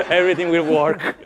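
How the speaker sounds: laughing through the words. Real, not acted. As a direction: chuckle as you finish the line. Joking about his Italian accent.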